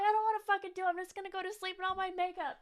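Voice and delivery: whiny refusal. Retold or imitated. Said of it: "I'm like".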